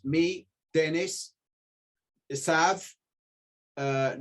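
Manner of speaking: normal talking voice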